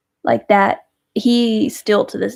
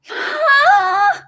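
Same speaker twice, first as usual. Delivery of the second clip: gasp; 3 dB louder than usual. Real, not acted. Surprised by a viewer's gift.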